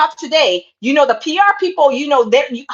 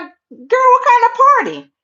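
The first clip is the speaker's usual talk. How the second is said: put-on higher, slow suspicious Girl; suspicious friend; 10 semitones higher than usual. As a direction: play the wary friend, drawn out. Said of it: "She gonna be like".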